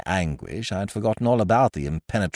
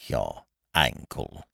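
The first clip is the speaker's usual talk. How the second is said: low, slow, quiet scorn; scornful echo; 9 semitones lower, 5 dB quieter, slower than usual. Acted. Visitor throws Ukridge's sore ankle back at him, scornful. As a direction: echo it with drawn-out contempt. Loathing echo of friend's complaint.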